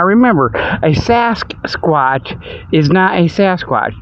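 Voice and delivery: ordinary talk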